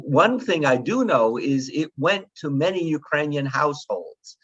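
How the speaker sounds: usual speaking voice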